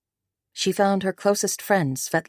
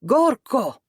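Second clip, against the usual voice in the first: high, ringing bellow; raised-pitch celebratory shout; bellowed toast; 8 semitones higher, 4 dB louder than usual. A narrator's reading. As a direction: bellow it across the room like a toast. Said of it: "raised his glass high and bellowed".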